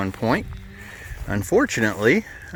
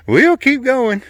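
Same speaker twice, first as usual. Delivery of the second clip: panting after the climb; breathless, gulping air; out of breath; 7 dB louder, slower than usual. Real, not acted. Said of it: "I'm out of breath".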